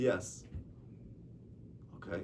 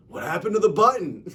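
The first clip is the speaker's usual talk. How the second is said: high, loud outburst of bafflement; exclaimed punchline of a baffled student; loud exclamation; 9 semitones higher, 12 dB louder than usual. Retold or imitated. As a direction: burst out with it, much higher and louder. Student bursts out, punchline, others laugh.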